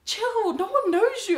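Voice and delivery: very high, loud mock dismissal; annoyed cousin. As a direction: imitate an exasperated cousin calling out. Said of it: "my cousins are annoyed".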